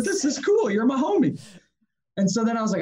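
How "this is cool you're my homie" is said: very high, giddy buddy talk; imitated high-pitched enthusiasm; retold excitement. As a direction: replay being thrilled for a pal, voice way up. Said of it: "for me, that was fun".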